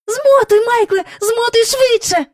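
high, loud, urgent cry; breathless urging. A narrator's reading. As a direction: urge him on, gasping with excitement. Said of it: "аж задихалася Джейн".

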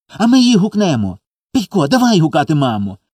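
put-on high, excited little-boy urging; eager child. A narrator's reading. Small boy urges brother to call mama, eager. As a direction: pitch it high and urge him on.

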